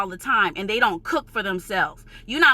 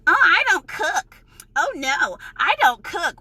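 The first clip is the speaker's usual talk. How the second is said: mocking lazy people; 5 semitones higher than usual. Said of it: "all of y'all being complacent and being lazy".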